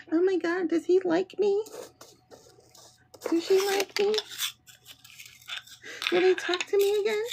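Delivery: put-on high, soft giddy teen voice; silly lovesick girl. Reader mocking young crushes in a high giddy voice. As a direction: gush like a lovesick schoolgirl. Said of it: "remember when we were silly".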